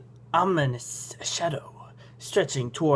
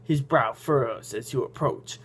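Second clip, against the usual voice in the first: normal talking voice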